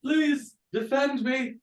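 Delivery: high, frightened plea in a put-on queen voice; pleading queen. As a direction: beg for protection as the fleeing queen. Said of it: "and she says".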